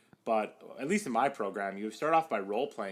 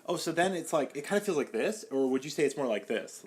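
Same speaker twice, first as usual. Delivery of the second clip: put-on Irvine California accent; 5 semitones higher, 3 dB louder than usual. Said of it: "sounded like I was from, like, Irvine, California".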